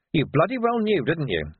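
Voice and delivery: indignant, high-pitched confrontation; accusing. Acted. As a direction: throw the accusation at them, dropping on the tag. Confronting Vetinari at the gates.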